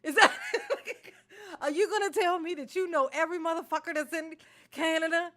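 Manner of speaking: laughing rant. Real, not acted. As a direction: laugh through the outrage.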